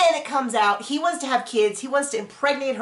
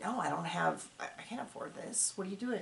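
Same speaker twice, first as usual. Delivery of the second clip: hushed, nervous; 3 semitones lower, 15 dB quieter than usual. Retold or imitated. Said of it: "And he's like".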